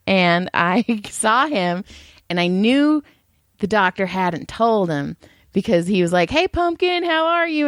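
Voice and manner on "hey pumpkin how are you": higher-pitched sing-out hello; cheery greeting. Retold or imitated. Patient greeting the masseuse, cheery and high-pitched. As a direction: greet them in a lifted, higher voice.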